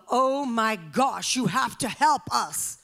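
loud, drawn-out frantic plea for help. Retold or imitated. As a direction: imitate people begging in a frenzy, slow and loud. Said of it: "it was this frantic frenzy of".

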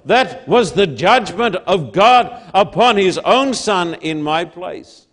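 slow, loud, weighty pulpit oratory; emphatic, drawn-out sermon proclamation; full-force preaching. Real, not acted. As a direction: drive each phrase home to the congregation.

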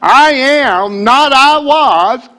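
preacher shouting in full fervor; preaching shout. Real, not acted. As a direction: let the spirit take over, shouting. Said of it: "it's coming on now".

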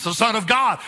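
usual speaking voice

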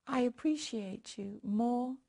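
hushed intimate prayer. Acted. Leads prayer.

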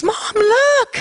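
put-on high-pitched excited kid calling mom; imitated child's eager squeal. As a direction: show off a find, thrilled. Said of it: "she was just excited".

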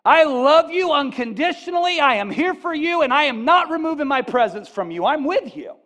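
loud, high passionate promise; Jesus's promise preached at full force; fervent preaching. Acted. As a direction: pour it out like a pledge of love. Voicing Jesus's promise with fervour.

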